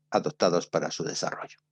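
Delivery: ordinary talk, nothing marked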